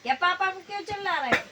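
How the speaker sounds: high, loud scolding shout; teacher yelling. Acted. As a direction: yell at the crying boy. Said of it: "तो फिर sir फिर चिल्लाए". (ऊँची आवाज़ में ज़ोर से डाँटना)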